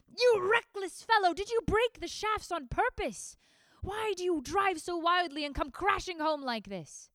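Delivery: loud, high scolding father; furious father shouting at his son; angry father. Acted. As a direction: bark at the reckless son.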